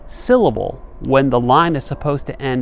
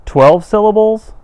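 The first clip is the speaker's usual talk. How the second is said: a much higher, louder mock gasp of disbelief; mock astonishment; 11 semitones higher, 9 dB louder than usual. Teacher pretends shock at twelve syllables, with a high, loud mock gasp. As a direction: act shocked at the count for the class.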